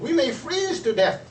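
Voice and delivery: mock alarm at freezing; fearful bird voice. Birds fear the cold winter.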